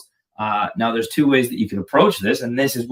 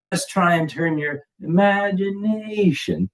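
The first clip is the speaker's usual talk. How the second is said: drawn-out, high, playful swell; over-caffeinated silliness; 5 semitones higher than usual. Real, not acted. Drummer on too much coffee urging learners, silly stretched word. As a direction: stretch IMAGINATION out slowly and playfully. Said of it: "Too much coffee".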